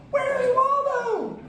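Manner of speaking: very high, slow squeaky puppet voice; bad Elmo impression. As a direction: squeal it like a furry red puppet. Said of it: "I'm really bad at impressions".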